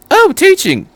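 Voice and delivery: very high, breezy "oh, teaching"; airily. A narrator's reading. Doctor answering Shinji, airily. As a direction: toss it off lightly. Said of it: "the doctor said airily".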